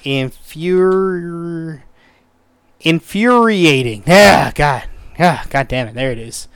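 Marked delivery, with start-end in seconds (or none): 0.0-4.0 Infuri- drawn out syllable by syllable; sounding it out carefully; sounded out slowly. Shown as a demonstration. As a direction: stretch out Infuri- slowly, copying the dictionary. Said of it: "Let's look it up".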